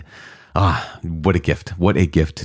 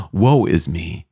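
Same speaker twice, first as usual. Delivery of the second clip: mock awed dread; 3 semitones higher, much slower than usual.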